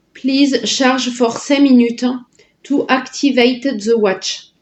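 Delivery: slow English read with a French accent; heavy French accent. Real, not acted. Reading the watch's charging instructions aloud, heavy French accent. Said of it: "J'ai un accent anglais de dingue".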